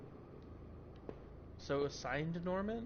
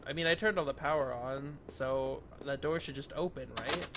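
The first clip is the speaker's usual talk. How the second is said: usual speaking voice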